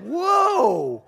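high, loud drawn-out recoil; startled reaction. Retold or imitated. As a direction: act out being taken aback, big and high. Taken aback by angry grandmother.

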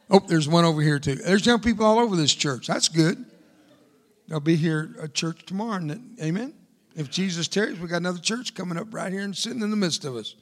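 ordinary talk, nothing marked

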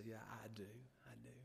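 very soft, low answer sinking to a whisper; hushed assent. Retold or imitated. Father answers his son's Bible question, hushed assent. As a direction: drop right down to a near-whisper. Father humbly accepts, retold.

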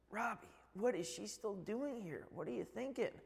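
annoyed dad. Retold or imitated. Dad confronting son about girlfriend.

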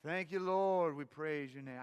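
a light imitation of his grandfather's lilting thanks; hint of an old pastor's sing-song prayer; sing-songy praise. Grandson imitating grandfather's praise to the congregation, mildly sing-song. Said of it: "it was, like, sing-songy".

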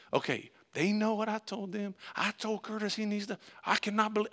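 high, held-in exasperated rant; fuming inside. Retold or imitated. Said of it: "I'm fuming on the inside".

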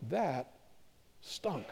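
muttered self-verdict. Retold or imitated. Pastor grumbling to himself after a bad sermon, muttered. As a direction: drop to a near-silent mutter.

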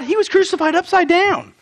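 ordinary talk

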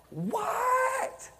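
mock Nathanael caught completely off guard; stunned disbelief. Nathanael reacts in retold scene.

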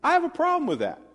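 lightly voiced servants, high and indignant; a hint of upset servants; servants complaining. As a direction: tell the master you object. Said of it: "they go back to the master and say".

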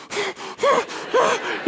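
high frantic yelp; a sudden high cry of alarm; panicked squeal. Retold or imitated. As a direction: squeal in mock panic, like running out of air. Panicking underwater at 100 feet.

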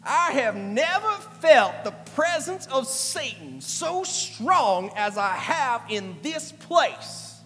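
loud, high, slow Southern drawl; thick Alabama accent, booming. Retold or imitated. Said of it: "he says in his thick Alabama accent".

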